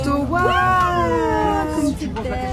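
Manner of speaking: high, loud and gushing; excited squeal. Real, not acted. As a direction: squeal it with delight. Excited selfies with friend.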